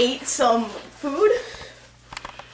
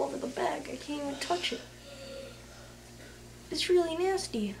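whispering; 3 semitones higher, 13 dB quieter than usual. Real, not acted. Whispered disgust.

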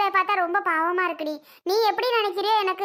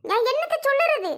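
exasperated; 6 semitones higher than usual. Acted. Exasperated mother-in-law.

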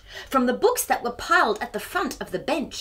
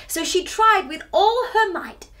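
plain reading voice

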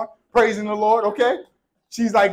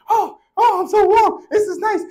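mock bird voice, much higher and louder, sighing with comfort; bird enjoying warmth; 12 semitones higher, 4 dB louder than usual. Pastor voicing the thawing bird in the barn, high mock delight. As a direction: squeak it out like a relieved little bird. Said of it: "He's like".

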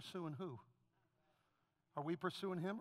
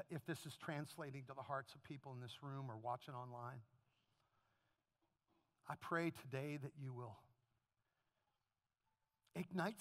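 softer, lower praying voice; quiet prayer; 7 semitones lower, 6 dB quieter than usual. Acted. Pastor praying over the congregation, soft and low. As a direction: pray it gently over the room.

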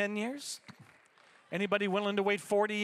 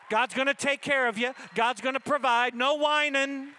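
loud, raised preacher's climax; full-force preaching; 5 semitones higher, 7 dB louder than usual. Real, not acted. Pastor promising God will provide, shouting it at full force. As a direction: punch out each promise to the congregation.

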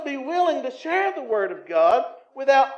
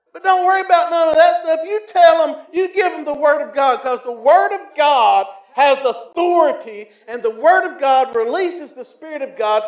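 loud, fervent preaching; 6 semitones higher, 10 dB louder than usual. Acted. Preacher urging the congregation to give God's Word, loud and fervent. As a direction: drive it home from the pulpit.

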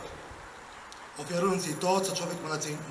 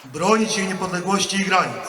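loud, measured oath line; formal oath dictation; 8 dB louder than usual. Real, not acted. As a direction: declaim each phrase for the ranks.

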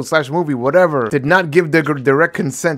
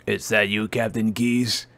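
quiet game character voice; 8 semitones lower, 10 dB quieter, slower than usual. Acted.

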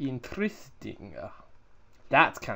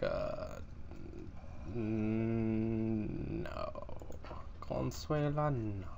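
flat refusal; 2 semitones lower, 5 dB quieter, much slower than usual. Retold or imitated. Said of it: "And I'm like".

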